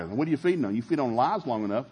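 ordinary talk, nothing marked

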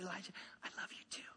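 breathy whispered reply to his son; whispering "I love you too" back to a five-year-old; tender whisper. Retold or imitated. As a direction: hush it out lovingly, barely voiced. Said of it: "and I whispered, I said".

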